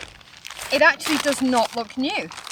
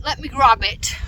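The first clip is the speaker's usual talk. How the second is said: normal talking voice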